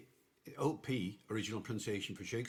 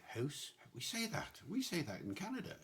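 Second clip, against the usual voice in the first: high-pitched, softer voice of a delighted Canadian; imitated Canadian surprise, rising high; surprised Canadians; 7 semitones higher, 4 dB quieter than usual. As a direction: pipe up in recognition, pitched high. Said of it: "Canadians, for example, will hear words".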